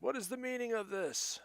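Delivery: loud, very high-pitched indignant demand; mage's shout. Acted. Game master voices mage walking out of tent, shouting. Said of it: "And he shouts".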